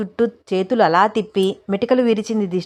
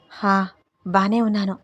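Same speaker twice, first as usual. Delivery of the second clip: plain reading voice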